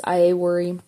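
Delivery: ordinary delivery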